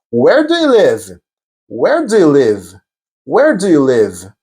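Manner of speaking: slow, drawn-out language drill; slow model question. Shown as a demonstration. Teacher presenting the next interview question, slowly. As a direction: repeat it slowly for the learner to catch.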